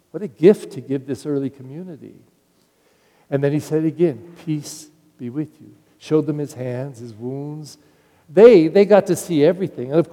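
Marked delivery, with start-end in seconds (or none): 4.5-5.7 much softer and tender; a quiet blessing; gentle greeting. Acted. Jesus greeting the apostles again, soft and tender.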